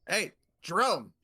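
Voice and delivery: loud, high, drawn-out call; yelling over. Acted. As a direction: holler a name across the swamp. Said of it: "So he yells over".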